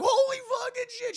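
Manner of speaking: high yelled holy fucking shit; family shouting as a moped crashes through the window; shocked shout. Retold or imitated. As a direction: scream it in disbelief.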